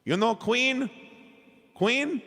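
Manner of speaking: imitating an elderly Chinese woman's accent, high and eager; high, sweet Chinese-accented English, asking hopefully; lightly hammed-up Chinese accent of an older lady; adorable Chinese accent. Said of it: "in her absolutely adorable accent".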